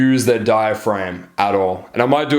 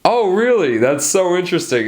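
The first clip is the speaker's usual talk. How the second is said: high, swooping supplicating tone; over-eager, high-pitched pleaser; people pleasing; 7 semitones higher than usual. Shown as a demonstration. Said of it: "supplicating, people pleasing kind of tonality".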